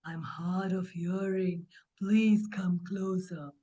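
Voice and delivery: low, slow, drawn-out crocodile voice. Acted. Uncle Crocodile coaxing Boo Boo closer, low slow voice. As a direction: lure gently, low and unhurried.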